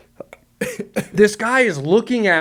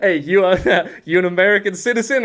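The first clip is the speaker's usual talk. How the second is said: laughing through the question, louder; guard laughing; 5 semitones higher, 5 dB louder than usual. Retold or imitated. Border guard questioning the giggling friends, laughing. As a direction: ask it while cracking up.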